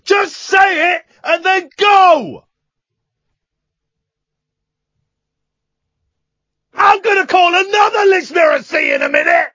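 high, loud exasperated yelling; exasperated shouting. Real, not acted. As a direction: yell at him in sheer frustration. Host exasperated, yelling at caller.

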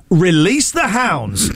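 slow, drawn-out imitation of a game show command; game master's command. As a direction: announce it like the official starting the chase. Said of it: "the person in charge would go".

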